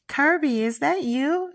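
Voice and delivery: high, loud call from another room. Acted. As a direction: yell his name across the house. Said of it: "she yells from the kitchen".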